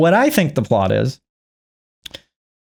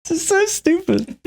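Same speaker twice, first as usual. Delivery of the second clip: laughing; 3 dB quieter than usual. Real, not acted. Laughing at herself.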